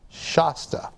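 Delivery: slow Shasta with a broad "ah"; Shasta, first vowel as in "Shaw"; Shasta as "SHAH-sta". Shown as a demonstration. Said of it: "Robert Price, says Shasta".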